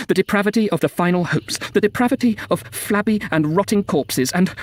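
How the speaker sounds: outraged, increasingly high-pitched tirade; mounting indignation. A narrator's reading. Narrator appalled by depravity among the dead, indignant outburst. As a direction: build from disgust to impassioned protest.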